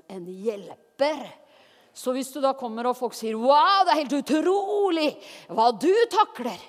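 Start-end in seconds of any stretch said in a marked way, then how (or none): 3.4-6.6 mock amazement. People marvelling at how someone copes, exaggerated amazement. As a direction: gush in hammed-up awe.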